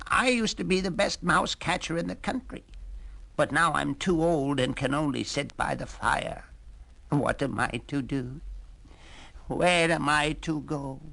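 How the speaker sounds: put-on an old cat lamenting his lost glory; self-pitying old cat.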